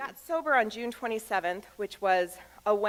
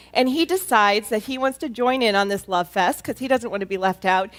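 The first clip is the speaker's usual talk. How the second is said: ordinary talk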